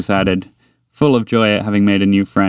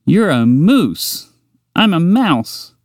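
patient mouse explaining; 8 semitones higher, much slower than usual. Acted.

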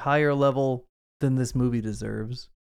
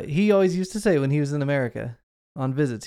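usual speaking voice

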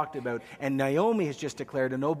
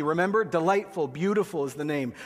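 usual speaking voice

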